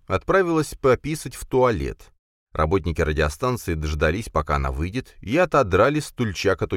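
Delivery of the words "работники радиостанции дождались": plain reading voice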